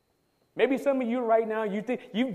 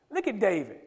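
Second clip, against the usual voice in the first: ordinary talk, nothing marked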